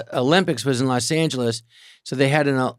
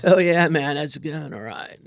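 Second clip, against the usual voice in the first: laid-back surfer; slower than usual. Retold or imitated. Recalling Phil talking surfing, slow surfer drawl. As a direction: ease it out like a mellow surfer. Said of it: "he'd say".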